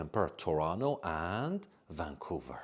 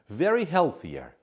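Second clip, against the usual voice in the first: very healthier high and loud, stressing very; wrong option read out; 9 semitones higher, 8 dB louder than usual. Shown as a demonstration. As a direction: present the wrong choice emphatically. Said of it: "we can't use very before comparative".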